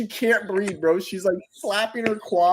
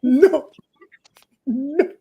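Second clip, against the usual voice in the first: high pitched denial; 9 semitones higher, 4 dB louder than usual. Retold or imitated. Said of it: "she's like".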